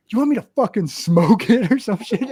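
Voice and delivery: mock the punk for asking too much; dad scoffs at the guy begging for a lighter, loud and high; mock scornful retort. Dad mocking the gangbanger.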